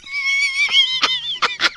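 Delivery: a burst of high-pitched laughter. Real, not acted. Caller bursting into high laughter at uncle's threat.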